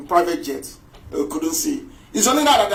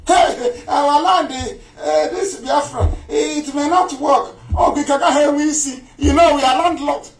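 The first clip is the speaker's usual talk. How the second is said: high, mock-worried whine of objection; mocking mimicry; 8 semitones higher than usual. Speaker mimics people who fear being landlocked, mocking. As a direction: parody the doubters with a raised pitch.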